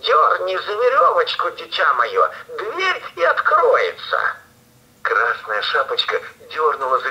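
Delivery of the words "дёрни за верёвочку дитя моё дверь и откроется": wolf squeaking high to pass as granny; thinner voice. Acted. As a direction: pitch it up thin and sweet, faking. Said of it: "и сказал потоньше".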